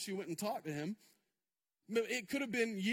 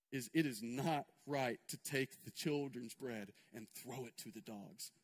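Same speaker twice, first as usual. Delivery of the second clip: hushed and low, drawing the room in; preacher hushing the church at the story's low point; 10 semitones lower, 8 dB quieter than usual. Acted. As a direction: drop to a near-hush for the kneeling mother. Hushed retelling of the kneeling scene.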